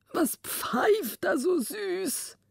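high, drawn-out marvelling; enraptured king. A narrator's reading. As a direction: play a king entranced by birdsong, slow and high.

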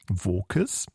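"Wokes" with a hard k in the middle, asked as a question; pronunciation guess. Shown as a demonstration. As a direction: try out "Wokes" with a k sound, questioning. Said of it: "wie werden die denn nun ausgesprochen".

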